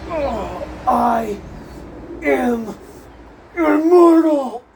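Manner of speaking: halting words building to a loud, high drawn-out cry; dramatic movie re-enactment. Acted. Barabbas defies the Roman soldier, dramatic dying boast. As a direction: declaim it word by word, then bellow the last. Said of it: "I'm gonna just recreate".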